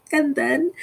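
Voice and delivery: usual speaking voice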